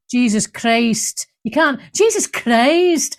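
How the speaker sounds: slow, stretched Scottish exclamation; Billy Connolly. Acted. Host slips into a comedian's voice, Scottish accent. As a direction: draw it out in a Scottish comic's accent. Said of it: "I've gone into Billy Connolly".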